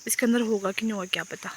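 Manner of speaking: usual speaking voice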